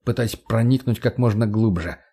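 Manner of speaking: plain reading voice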